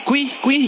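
high little cuy chirps; imitated chirps. Streamer mimicking the game, high chirps. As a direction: chirp like a tiny critter.

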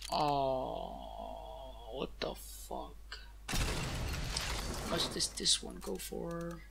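slow, breathy disbelief; hushed whisper. Real, not acted. Streamer reacts to a drop, whispering slowly. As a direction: whisper it in awe.

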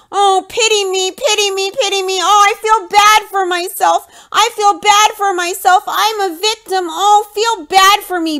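high, sing-song self-pitying whine; sneering sing-song parody of a victim; mocking whiny Cody. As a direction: ham up a pathetic pity-me wail. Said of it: "I can't stand this".

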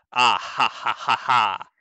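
measured, spaced-out "ah ha ha"; put-on laugh; deliberate read-out laugh. Scripted laugh while reading comment.

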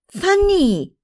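high, falling cry of the name; shocked reproach. A narrator's reading. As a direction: call her out, pitch leaping up. Reproachful cry at friend's remark. (richiamo scandalizzato, acuto)

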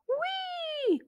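very high gleeful whee. A narrator's reading. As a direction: squeal with delight. Bouncing fun.

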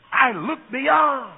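slow, loud, "beyond" held and falling; climactic shout. Acted. Preacher voicing Abraham, slow ringing shout. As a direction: cry it out as a vision.

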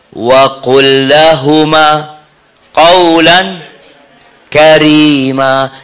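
very slow, elongated verse reading; Quran recitation. Real, not acted. Preacher quotes verse on speaking to parents, slow recitation. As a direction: recite each word apart, vowels held long.

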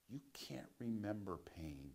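hushed whisper. Real, not acted. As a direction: whisper it like a secret. Confiding lesson.

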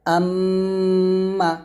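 আম্মা with the doubled m hummed long through the nose; nasal ghunnah. Shown as a demonstration. Teacher gives ghunnah example, আম্মা long and nasal. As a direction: hold the m of আম্মা steady in the nose, then release. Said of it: "নাকের বাসি হয়তো গুন্না করে".

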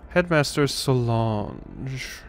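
"Solange" drawn out slowly as so-LAHNZH; corrected pronunciation. Shown as a demonstration. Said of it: "it's [name], not [name]".

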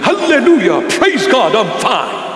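lightly mocked zealot gushing praise; mock pious answer. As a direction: ham up an over-holy greeting. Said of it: "Can you talk normal?".

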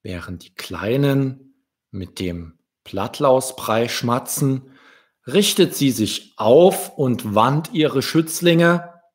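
ordinary delivery

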